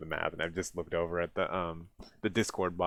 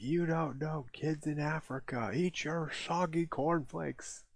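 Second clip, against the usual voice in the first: much higher, slow mock mom lecture; imitating mom's lecture, higher and slower; mom scolding; 8 semitones higher, slower than usual. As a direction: play the nagging mom, drawn out. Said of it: "And my mom would be like".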